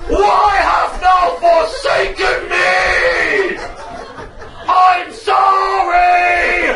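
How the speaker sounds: high, loud, slow wailing plea; a man on his knees in Tesco howling for forgiveness, very slow; anguished shout to God. Retold or imitated. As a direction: cry out to the heavens, dragging each word. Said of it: "and I go".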